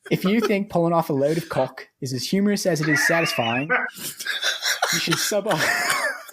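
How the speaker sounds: mock Australian accent, rising high on the last words. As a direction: keeps up the put-on Aussie voice and breaks into laughter at the end. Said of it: "Get in character".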